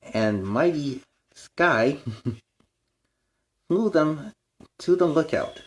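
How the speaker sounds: bright, storybook read-aloud; reading to children. A narrator's reading. Reading a picture book aloud to young fans. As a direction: read it like bedtime to little kids.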